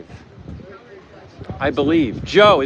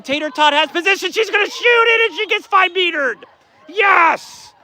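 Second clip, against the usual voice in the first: much higher, racing live call building to a yell; excited play-by-play; 9 semitones higher than usual. Real, not acted. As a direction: call the steal like the crowd's on its feet.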